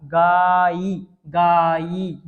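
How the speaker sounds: गाई stretched very slowly; teacher drilling syllables; very slow drawn syllable. Shown as a demonstration. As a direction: hold गाई long. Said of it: "ये लिखा है गायिका".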